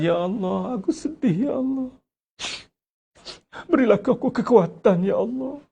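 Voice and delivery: hammed-up whine of sorrow; mock sad prayer to God; mock pleading prayer. Preacher voices a self-pitying prayer.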